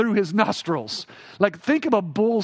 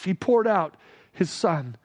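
normal talking voice

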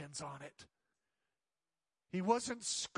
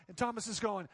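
usual speaking voice

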